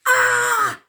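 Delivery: high loud cry of pain. A narrator's reading. Elsie poked in the eye, high cry of pain. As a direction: yelp in pain. Toes stamped on.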